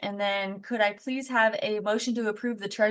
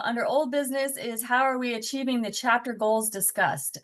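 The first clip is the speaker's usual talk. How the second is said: usual speaking voice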